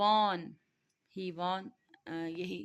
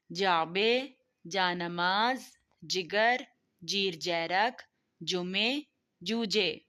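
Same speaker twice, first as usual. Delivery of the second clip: louder model words, one at a time with pauses; vocabulary drill reading; 5 dB louder, much slower than usual. Shown as a demonstration. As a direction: call each word out clearly for the class to repeat. Teacher reads vocabulary list aloud.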